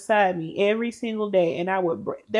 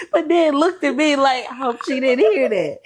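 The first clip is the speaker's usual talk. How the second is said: laughing retelling; 7 semitones higher, 7 dB louder than usual. Retold or imitated. Mocking friend's guilty look.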